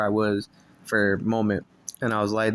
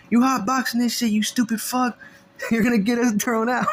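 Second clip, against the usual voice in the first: angry scolding; 13 semitones higher, 4 dB louder than usual. Retold or imitated. OG scolding him.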